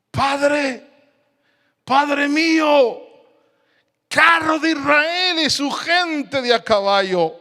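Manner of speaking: high, drawn-out impassioned cry; grito de Eliseo. Acted. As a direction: cry out to a departing master. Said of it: "empezó a gritar". (clamor agudo y prolongado)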